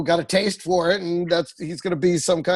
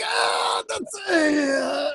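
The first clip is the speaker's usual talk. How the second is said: mock wail; 9 semitones higher, much slower than usual. Friend acting out the dad's panic, mock wailing high. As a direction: whine it out like a busted dad.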